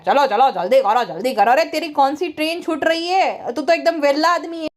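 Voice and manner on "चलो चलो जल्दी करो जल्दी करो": low-pitched mock hurrying; mimicked impatient man. Vlogger mimicking him hurrying over everything, mock impatience. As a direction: imitate him rushing people, voice dropped.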